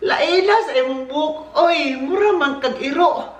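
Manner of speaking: high, slow mocking tease; teasing sister. Sister mocking her brother's hair, high and slow. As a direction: tease him like a cheeky older sister. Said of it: "Sa Tagalog ba, sabi niya".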